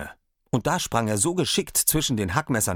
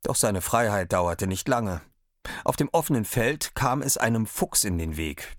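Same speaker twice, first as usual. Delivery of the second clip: ordinary delivery, nothing marked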